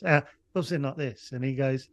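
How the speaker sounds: ordinary delivery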